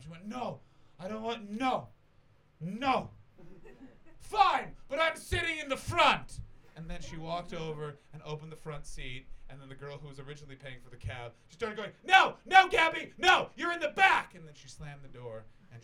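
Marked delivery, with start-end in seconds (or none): 0.3-6.4 rising into a high loud shout; protest, then give in loudly; drunken protest. Retold or imitated. Drunk Gabby refusing her friend, building to a shout. 12.0-14.3 shrill loud yelling, much higher; frantic shouting. Retold or imitated. Friend yelling at Gabby about the front seat.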